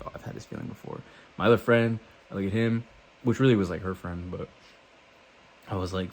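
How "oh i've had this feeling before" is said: breathy hushed whisper. Retold or imitated. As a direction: whisper it to yourself in awe. Quiet realization while high.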